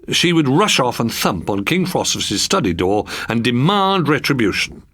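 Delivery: plain reading voice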